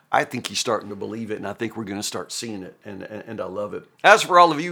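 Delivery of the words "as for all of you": raised, louder address; Job's challenge. Acted. Preacher voices Job taking on his friends, challenging. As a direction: round on the whole group.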